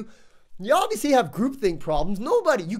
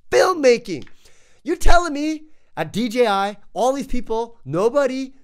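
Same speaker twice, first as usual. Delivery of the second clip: punched out, much louder; loud indignant rant; 5 semitones higher, 8 dB louder than usual. Real, not acted. Reviewer venting at DJI over left-handed design, loud. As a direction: hammer the point home in anger.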